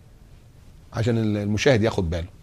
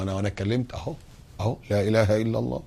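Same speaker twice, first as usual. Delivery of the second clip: quiet reply to a criminal; 2 semitones lower, 8 dB quieter, faster than usual. Retold or imitated. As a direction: murmur it so no one sees. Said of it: "حتى لو واحد من المجرمين يقول لك". (رد خافت على المجرم)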